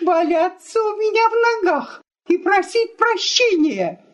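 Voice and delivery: high-pitched, scornful prediction; vengeful wife. Acted. Wife foretells husband's begging, indignant and scornful. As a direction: lecture him as an angry wife, pitched high.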